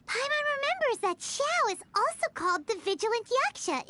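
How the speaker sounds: usual speaking voice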